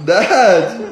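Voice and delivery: loud, exasperated burst; high, loud exasperation; exasperated outburst. Real, not acted. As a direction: blurt it out loudly at the prankster. Fed up with prank humming.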